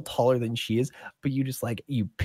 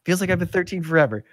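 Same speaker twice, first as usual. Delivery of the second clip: put-on high, eager young voice; thirteen-year-old kid; 6 semitones higher, 5 dB louder, faster than usual. Kid answering an adult about age, high young voice. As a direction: play a kid impatient to grow up.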